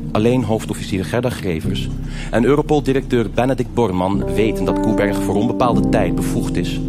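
usual speaking voice

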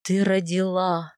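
low, slow, dazed husband; lost, wondering male character; bewildered. A narrator's reading. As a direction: announce it in stunned disbelief. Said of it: "раздался растерянный голос супруга".

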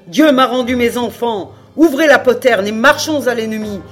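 fervent full-voiced battle cry; anguished father's shout. A narrator's reading. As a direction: shout commands as a father saving his children. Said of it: "avec un accent terrible de douleur paternelle".

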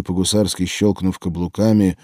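usual speaking voice

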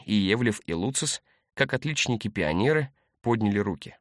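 usual speaking voice

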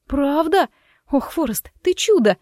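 delighted, high-pitched burst; joyful exclamation. A narrator's reading. Wife throwing herself on her husband's neck, joyful exclamation. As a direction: exclaim with thrilled gratitude, voice leaping up. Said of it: "воскликнула я".

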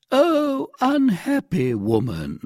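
very high, loud start, falling away slowly; dismayed cry. A narrator's reading. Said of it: "cried the commissary".

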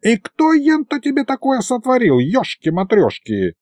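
put-on high-pitched old wizard's exclamation; cranky old sorcerer. Old sorcerer asks who cast the spell, crankily. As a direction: ask like an indignant little old mage.

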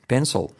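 "Pencil" ending in a backed 'ol'; dark L. Shown as a demonstration. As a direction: PENCIL with the L almost unheard. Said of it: "casi no se oye".

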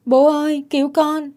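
put-on high, desperate child's call for help; acted high-pitched scream for rescue; child crying out. A narrator's reading. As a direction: cry out to father as a drowning boy. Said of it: "không van xin mẹ nữa mà kêu lên".

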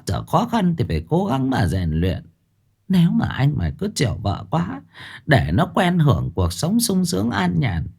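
mother coaxing her son with folk wisdom. Acted. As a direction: pat his shoulder and win him over. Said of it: "vỗ vai con".

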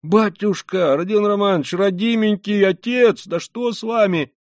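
high, loud, anxious pleading; frantic, high-pitched concern; alarmed. A narrator's reading. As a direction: cry out to him in fright. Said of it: "в совершенном испуге Порфирий Петрович".

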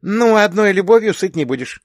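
high and loud, suddenly lively; a cheery call to the table; perking up. A narrator's reading. Sister brightly calls everyone to dinner. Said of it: "оживилась сестрёнка".

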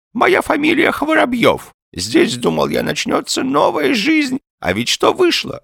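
put-on high, aggrieved old man's voice; old man lamenting his lot in a high voice; plaintive old man. A narrator's reading. Old man begins his sad story.

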